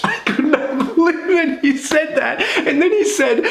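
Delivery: high-pitched, disbelieving laughter through the words; real laughter squeezing the voice high; laughing while talking. Real, not acted. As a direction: crack up while trying to keep talking. Cracking up at retold joke.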